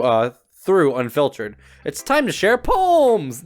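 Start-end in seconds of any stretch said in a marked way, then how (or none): 1.8-3.4 1 excited announcement. Acted. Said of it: "I smiled brightly ... excitement came through".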